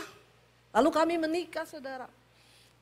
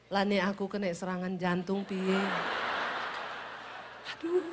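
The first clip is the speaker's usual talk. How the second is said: low, soft Javanese lament; gentle Javanese husband; 5 semitones lower, 6 dB quieter than usual. Retold or imitated. Husband worrying about a heart attack from the noise, soft Javanese lament. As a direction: fret quietly in a Javanese lilt. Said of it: "Orang Solo kaget".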